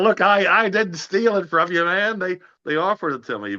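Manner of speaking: high, pleading protest; defensive protest. Retold or imitated. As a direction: insist you didn't steal the part. Defending himself to his friend.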